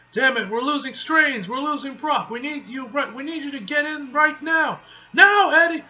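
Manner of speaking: high, urgent, building to a loud shout; urgent command. Acted. Hero urging Eddie to get in, urgent shout. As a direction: press him hard, then shout the last order.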